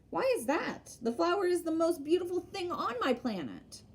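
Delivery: a gently voiced small prince, simple and earnest; little prince. A narrator's reading.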